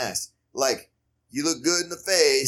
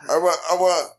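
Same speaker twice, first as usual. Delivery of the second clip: higher, louder, slow put-on accent; mock Gabby accent; 5 semitones higher, 4 dB louder, slower than usual. Pushy Gabby demanding, higher, louder put-on accent. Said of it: "you gotta do the accent".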